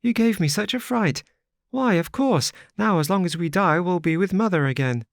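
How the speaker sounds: put-on very high, quick little girl, delighted; a child chattering happily about seeing mother; gleeful child. A narrator's reading. Little girl gleefully tells the wanderer they'll meet mother, high and quick. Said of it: "laughing gleefully".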